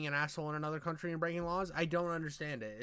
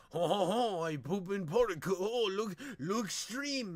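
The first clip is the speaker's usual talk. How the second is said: mock French accent; 5 semitones higher, slower than usual. Mocking a rude tourist in the park with a hammy French accent. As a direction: play a cartoon French tourist in bad French accent. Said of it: "and he's, like, speaking in, like, French".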